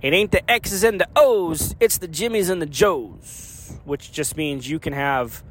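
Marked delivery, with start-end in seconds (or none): 0.0-3.8 put-on higher-pitched Southern coach accent. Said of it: "in a real Southern accent".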